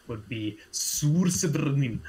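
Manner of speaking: slow careful Sursebrnin, drawn out; odd pronunciation. Shown as a demonstration. GM demonstrating the name's pronunciation, slow and careful. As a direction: sound out Sursebrnin slowly. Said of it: "the pronunciation here would".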